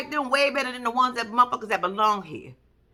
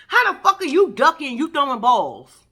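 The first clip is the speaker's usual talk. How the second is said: ordinary delivery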